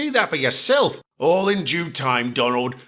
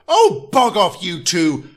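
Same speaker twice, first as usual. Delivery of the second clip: fed-up loud brush-off; irritated outburst; 4 dB louder, slower than usual. Acted. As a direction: tell them off sharply. Engine snapping at two others.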